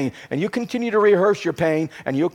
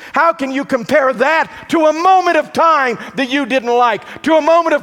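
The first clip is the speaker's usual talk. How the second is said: higher, louder preaching build, hammering each line; full-force preaching; 7 semitones higher, 4 dB louder than usual. Acted. Pastor driving the point home to congregation, full-force preaching. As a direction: preach it out, rising to the peak.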